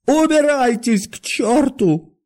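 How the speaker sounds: high, drawn-out wailing shout; tearful cry. A narrator's reading. Doctor cursing departing guests, tearful cry. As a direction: cry it out as if close to tears. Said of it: "крикнул он плачущим голосом".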